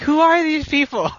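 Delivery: high-pitched mock bewilderment; baffled listener. Host voicing listeners who don't know the names, mock baffled. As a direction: ask it high, as a puzzled audience.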